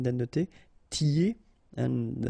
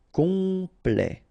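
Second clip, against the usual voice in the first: "complet" with a long, higher first syllable; drawn out; 5 semitones higher, 3 dB louder than usual. Shown as a demonstration. French teacher modelling for learners, "complet" drawn out. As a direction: stretch "complet" into com-plet.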